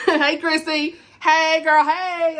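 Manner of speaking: loud, high, bubbly hello to a friend; excited greeting. Real, not acted. Greeting friend Chrissy in the comments, loud and high.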